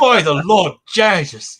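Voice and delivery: loud, high mock shout; loud mock exclamation. Mock reaction to the accent.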